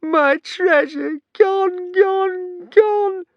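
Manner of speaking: very high, loud, slow sobbing wail; wailing like a baby. A narrator's reading. Alvin mourning his sunk treasure, baby wail. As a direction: sob each word like a baby. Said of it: "cried like a baby".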